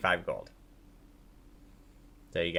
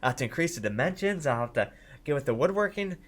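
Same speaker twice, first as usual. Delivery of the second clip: higher, swooping, excited appraisal; impressed blacksmith; 6 semitones higher than usual. Acted. Blacksmith admiring the offered blueprints, higher-pitched and enthusiastic. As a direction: think aloud eagerly in a higher voice. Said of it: "she turns to her and says".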